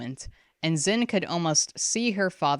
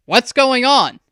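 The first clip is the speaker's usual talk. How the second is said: loud, higher demanding father; 8 semitones higher, 12 dB louder than usual. A narrator's reading. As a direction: demand an answer. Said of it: "Hyaktu demanded".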